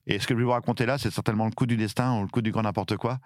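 usual speaking voice

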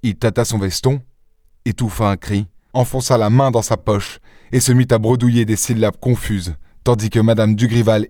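ordinary delivery

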